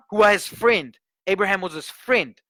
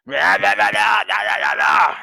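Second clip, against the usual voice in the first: low imitated demon growl, louder; demonic growl; 4 semitones lower, 5 dB louder than usual. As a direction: mimic a growling possessed voice. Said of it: "suddenly I hear".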